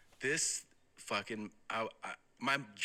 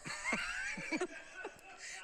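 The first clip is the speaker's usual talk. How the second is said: stunned laugh; 4 dB quieter than usual. Real, not acted. End of the story.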